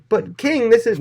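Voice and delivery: a servant begs the king, high and strained; pleading servant. Retold or imitated. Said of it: "the guy's like".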